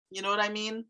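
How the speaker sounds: "You know what I mean?" with the tone going up; questioning rise. Shown as a demonstration. Teacher showing learners how a question's tone rises, questioning rise. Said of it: "My tone went up".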